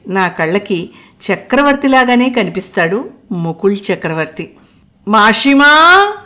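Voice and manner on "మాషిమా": loud, long-drawn call upward. A narrator's reading. Mukul calls his aunt out of the house, loud call. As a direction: call her from the doorway. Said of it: "అంటూ కేక వేశాడు".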